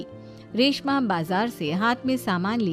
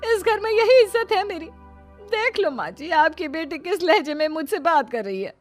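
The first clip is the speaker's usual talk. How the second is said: high-pitched wounded indignation; aggrieved outburst; 7 semitones higher than usual. Acted. Sister-in-law complaining of disrespect to mother-in-law, aggrieved. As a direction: play the insulted victim.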